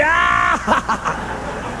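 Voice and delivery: breathy mock howl of laughter; imitated group roar. Whole group laughing at a speaker's disaster, mimicked howl. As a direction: act out a crowd cracking up. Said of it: "And we're all going".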